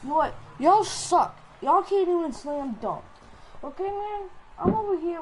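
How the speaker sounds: loud, high-pitched indignant outburst; fed-up rant. Real, not acted. Player telling teammates they can't dunk, indignant outburst.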